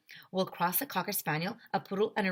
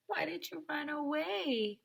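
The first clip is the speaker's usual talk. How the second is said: put-on higher soft childlike voice; child's question; 9 semitones higher, 5 dB quieter than usual. Ryan asking the dog, high and soft. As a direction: ask like a small child.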